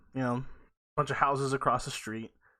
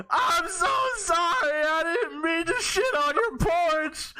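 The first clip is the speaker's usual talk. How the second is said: high, slow mock wail; hammed-up sad apology; 14 semitones higher, slower than usual. Embarrassed kid apologizing to the angry lady, high drawn-out mock wail. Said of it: "I'm kinda sad. So I go".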